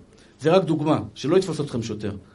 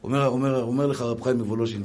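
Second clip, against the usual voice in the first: ordinary talk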